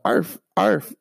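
light mock dog bark; imitated barking. Father dog on the hill.

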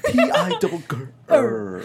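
high, slow, laughing Tigger spelling. Pretend. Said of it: "do a Tigger impression".

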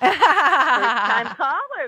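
slow, laughing reply. Real, not acted. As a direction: laugh through the words. Caller repeats host's line laughing.

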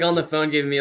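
normal talking voice

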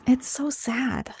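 slow, hushed whisper echoing sadness. Real, not acted. Whispering back to her co-host that the story is sad. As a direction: breathe it out slowly, barely voiced.

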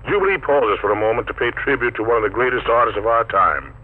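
normal talking voice

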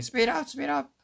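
high-pitched mock scream; retold scream. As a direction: imitate frantic yelling at a driver. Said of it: "Rigby screams out to Mordecai".

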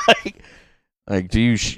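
ordinary talk, nothing marked

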